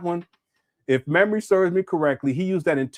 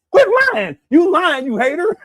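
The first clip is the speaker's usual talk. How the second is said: high, loud mock shout as Umar; hammed-up rant imitating Umar; mock furious shout; crashing out like Umar; 13 semitones higher, 8 dB louder than usual. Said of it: "crashing out like Umar".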